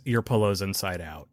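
mild imitation of a sweet reassurance; tender line. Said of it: "The way she says".

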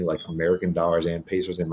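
usual speaking voice

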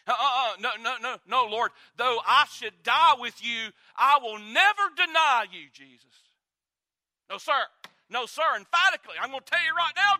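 loud, high, outraged refusal; indignant protest. Retold or imitated. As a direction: protest hotly to the Lord. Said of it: "Simon Peter got indignant with Jesus".

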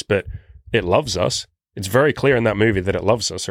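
ordinary talk, nothing marked

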